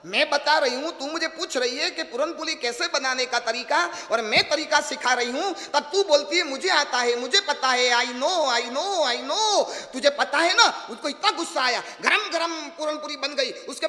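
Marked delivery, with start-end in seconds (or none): 0.1-10.7 put-on loud scolding old woman; old woman angry. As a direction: scold her loudly, fed up. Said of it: "अब गंगा माँ को गुस्सा आया". (गुस्से में ज़ोर से डाँटती बूढ़ी)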